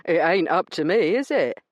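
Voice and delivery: a smug weasel of a man brushing her off; rough dealer. Acted. Branson dismissing Io's complaint, smug and rough.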